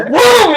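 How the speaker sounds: high, loud impact noise; excited laughing "boom"; shouted boom. Retold or imitated. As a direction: yell the swing as a sound effect. Imitates the bat swing.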